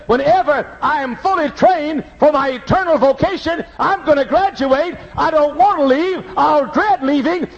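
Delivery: high, pressing preacher cadence; preaching. Acted. Pastor urging the congregation, high preaching.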